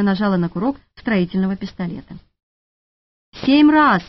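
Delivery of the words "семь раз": indignant outburst, higher and louder; flaring up. A narrator's reading. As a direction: blow up at her in disbelief. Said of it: "взвился Витька". (взвившись, громко и возмущённо)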